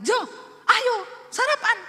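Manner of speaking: loud, high, rising call across the house; wife calls husband to breakfast in a loud high voice; loud Sulawesi call. Retold or imitated. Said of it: "nada belakangnya tinggi".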